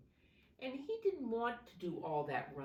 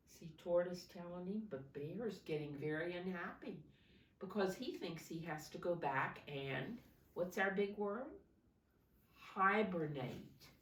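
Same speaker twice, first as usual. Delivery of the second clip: usual speaking voice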